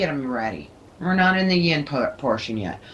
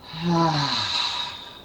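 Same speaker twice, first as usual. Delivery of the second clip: release sigh. Shown as a demonstration. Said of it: "take a deep breath in and go".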